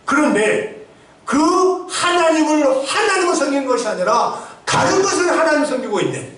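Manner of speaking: high-pitched, emphatic pulpit delivery; forceful preaching. Real, not acted. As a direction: preach it hard, driving each phrase up high. Preaches with raised voice.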